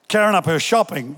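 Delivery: ordinary delivery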